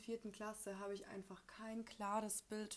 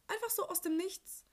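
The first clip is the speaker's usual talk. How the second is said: surprised; 10 semitones higher, 5 dB louder than usual. Real, not acted. Erzählt überraschtes Geldgeschenk.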